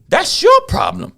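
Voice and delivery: high, slow scornful jab; high dismissive jab. Real, not acted. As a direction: toss it off with scorn.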